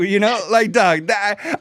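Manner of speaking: normal talking voice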